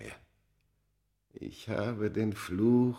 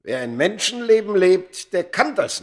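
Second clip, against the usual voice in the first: higher, louder fervour; impassioned outburst; 10 semitones higher, 8 dB louder than usual. Acted. The man lecturing Smith on mortal life, loud impassioned. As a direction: declaim it with passion.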